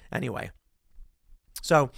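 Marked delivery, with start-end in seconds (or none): none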